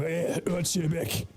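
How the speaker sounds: a mock dwarf grunt, like everyone else does it; gravelly dwarf voice. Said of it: "everyone's doing the same".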